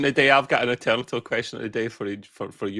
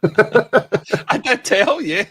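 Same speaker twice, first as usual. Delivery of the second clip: high, loud laughing reply; 8 semitones higher, 7 dB louder, much slower than usual. Real, not acted. Co-host told he should have lied, laughing. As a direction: talk through a big burst of laughter.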